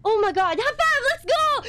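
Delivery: loud, high, thrilled cheer; excited celebration. Real, not acted. Celebrates a great find.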